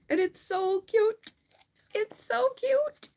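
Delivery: high, drawn-out cooing; gushing. Real, not acted. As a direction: coo over something adorable, pitch climbing. Gushing over a tiny mouse book.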